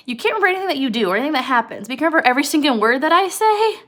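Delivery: George exasperated. Retold or imitated. George fed up with forgetful Lenny, fast exasperated outburst. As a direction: imitate an exasperated scolding, rushed and rising. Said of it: "he's like, "Oh my gosh".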